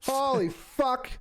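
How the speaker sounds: high, slow, shaken exclamation; drawn out as the scare sinks in; real shock. Real, not acted. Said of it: "fucking terrified me".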